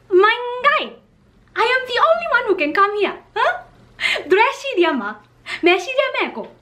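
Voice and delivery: venting disbelief to her manager; exasperated aside to manager. Retold or imitated. Model venting to manager about the director, high exasperated rant. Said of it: "I looked at my manager and I was like".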